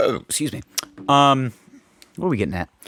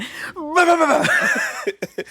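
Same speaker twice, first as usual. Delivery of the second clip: exaggerated throat clearing. Real, not acted. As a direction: noisily clear your throat, then laugh. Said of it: "Let me just clear my throat".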